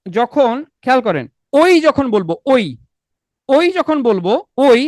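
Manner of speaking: ওই spoken slowly, gliding from ও to ই; slow careful ওই; slow glide demo. Shown as a demonstration. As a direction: draw out ওই so both vowels show. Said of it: "তখন কি হয় দেখেন".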